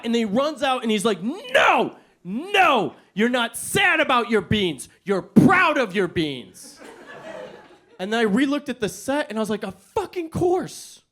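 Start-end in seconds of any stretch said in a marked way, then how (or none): 1.2-6.8 high, loud, slow insistence; excited correction. Retold or imitated. Director rushing in to redirect the actor, excited. 9.6-11.0 high, slow, hammed-up epiphany; mock realization. Actor realizing his character is proud, mock epiphany.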